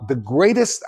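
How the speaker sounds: ordinary talk, nothing marked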